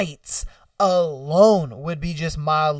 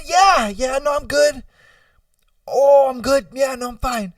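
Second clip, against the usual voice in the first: higher-pitched, hammed-up I'm fine; strained reassurance; 7 semitones higher, 4 dB louder than usual. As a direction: insist you're okay while suffering. Itchy person pretends to be fine.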